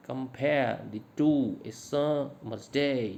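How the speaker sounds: slow scansion, comPARE the TWO a SUMmer's DAY; stress every second syllable. Shown as a demonstration. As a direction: stretch "pare", "two", "sum" and "day", clip the syllables between. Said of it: "इस तरह से बोलते हैं इसको". ("compare the two, a summer's day" में हर दूसरे syllable पर खींचकर ज़ोर)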